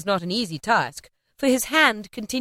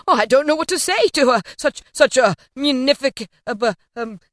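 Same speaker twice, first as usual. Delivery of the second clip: high, loud, then stammering to a halt; overwhelmed gentleman, flustered; 5 semitones higher, 6 dB louder than usual. A narrator's reading. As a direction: sputter and lose the words.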